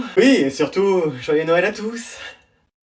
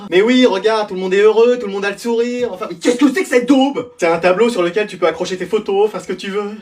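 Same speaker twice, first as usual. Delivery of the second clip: ordinary talk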